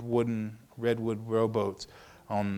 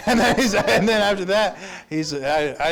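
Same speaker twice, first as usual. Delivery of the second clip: laughing; 8 semitones higher, 7 dB louder than usual. Real, not acted. Laughing while retelling the meeting.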